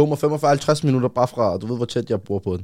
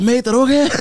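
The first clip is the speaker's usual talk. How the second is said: high, loud mock alarm; imitated high-pitched worried shout; alarmed passerby; 12 semitones higher, 7 dB louder than usual. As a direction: call out urgently as the stranger finding him. Acting out the stranger who found him.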